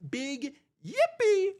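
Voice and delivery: very high, slow squealed yippee; excited squeal. Acted. Host squealing with joy over a big super chat. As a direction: squeal it out like a giddy kid.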